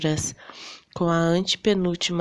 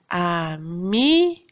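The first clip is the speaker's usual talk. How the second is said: Amigo slowed right down, MI rising and louder; stress on middle syllable; 3 dB louder, much slower than usual. Shown as a demonstration. Teacher proving which syllable is stronger, drawn-out stress on MI in Amigo. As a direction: lean long and hard on MI in Amigo. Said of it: "que a gente pronuncia com mais força?".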